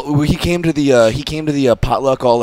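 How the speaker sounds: ordinary delivery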